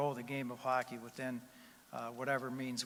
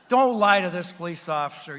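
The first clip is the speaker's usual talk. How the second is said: loud, higher scolding; scolding wife; 5 semitones higher, 15 dB louder than usual. Retold or imitated. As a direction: shout at him not to lie. Wife scolds husband in joke.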